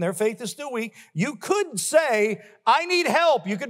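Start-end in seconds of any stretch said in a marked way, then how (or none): 2.7-3.5 higher, louder plea; pleading quote. Acted. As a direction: cry out for help. Voicing a believer's plea.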